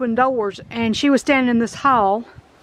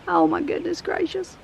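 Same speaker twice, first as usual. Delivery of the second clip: awed; 6 semitones higher, 6 dB quieter than usual. Real, not acted. Seeing childhood home ruined.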